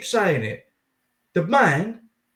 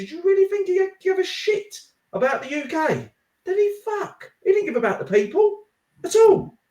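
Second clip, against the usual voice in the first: rising rant; 9 semitones higher than usual. Real, not acted. Podcast guest rants about Sunak's millions, voice climbing higher. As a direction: pitch climbing, indignant.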